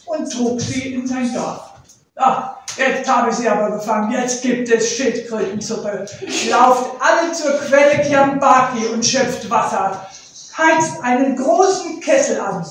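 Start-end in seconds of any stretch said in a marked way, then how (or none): 2.2-12.7 loud, bossy barked commands; commanding orders. Acted. Leopard ordering his villagers to prepare the soup, loud commands. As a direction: drive everyone to work, much louder.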